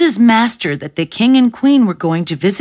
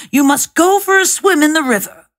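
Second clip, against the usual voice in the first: higher, fast and eager; excited urging; 7 semitones higher, faster than usual. A narrator's reading. Cat hurries his master to the river, fast and eager. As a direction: rush the order out eagerly.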